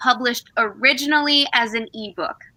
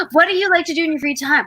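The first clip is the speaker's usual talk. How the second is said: quick, higher quoted question; quoted interviewer line; 5 semitones higher, much faster than usual. Retold or imitated. Quoting the parody video's interviewer, quick and bright. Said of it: "she'd be like".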